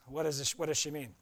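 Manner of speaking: ordinary talk, nothing marked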